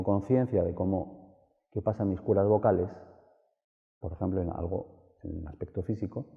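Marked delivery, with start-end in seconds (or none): none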